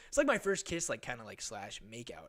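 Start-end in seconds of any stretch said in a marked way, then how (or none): none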